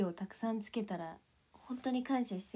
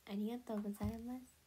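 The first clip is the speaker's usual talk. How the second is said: ordinary talk, nothing marked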